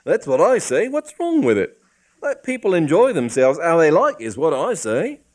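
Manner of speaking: high, loud cockney outburst; excited policeman. Acted. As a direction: work yourself up into a rant. Policeman gets worked up.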